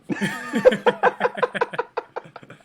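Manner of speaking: high, wheezy burst of laughter. Real, not acted. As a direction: crack up at the punchline. Laughing at retold comedian's line.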